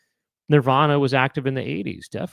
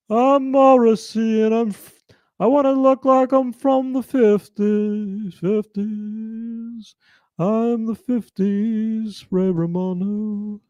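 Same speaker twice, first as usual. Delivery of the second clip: much higher, louder, slow crooning voice; 11 semitones higher, 4 dB louder, slower than usual. Retold or imitated. Streamer acting out a greaser [name] for chat, high slow croon. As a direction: ham up a fifties greaser crooning. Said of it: "a greaser from the fifties, he would sound like [name]".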